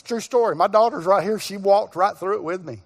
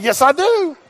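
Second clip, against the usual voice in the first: higher, emphatic; triumphant retort; 5 semitones higher, 3 dB louder than usual. Retold or imitated. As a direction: come right back at her doubt. Retort to doubting banker.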